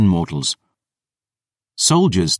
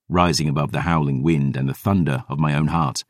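ordinary delivery

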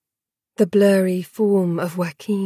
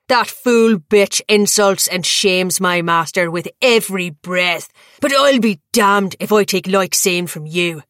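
louder ranting snarl; furious tirade; 5 dB louder than usual. A narrator's reading. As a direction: rage at him without pause.

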